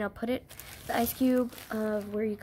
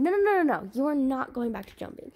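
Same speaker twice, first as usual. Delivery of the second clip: ordinary talk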